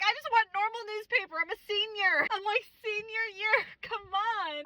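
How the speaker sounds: high, pleading senior whine; whiny protest. Retold or imitated. Senior pleading with teacher to stay in normal class, high whine. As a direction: beg your way out of it. Pleading against advanced placement.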